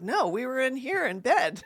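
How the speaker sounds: high-pitched innocent denial; feigned innocence. Retold or imitated. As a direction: act innocent to cover a fib. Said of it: "you're gonna love the acting".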